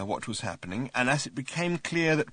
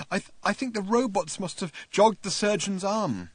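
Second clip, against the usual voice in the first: tearful; 9 semitones higher than usual. A narrator's reading. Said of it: "He started to cry".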